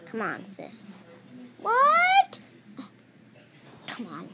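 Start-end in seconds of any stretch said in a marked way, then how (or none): 1.6-2.4 high, loud shocked shriek. Pretend. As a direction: shriek it in disbelief.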